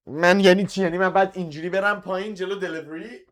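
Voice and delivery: real exasperated disbelief; incredulous protest. Real, not acted. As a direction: protest being asked to go down now. Interrupted mid-class by a delivery.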